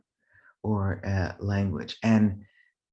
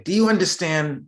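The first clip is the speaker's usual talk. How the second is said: raised, higher-pitched mock scolding; annoyed dad; 8 semitones higher, 5 dB louder than usual. Annoyed dad to his child, mock scolding. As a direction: imitate an annoyed father, louder. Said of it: "if he got annoyed".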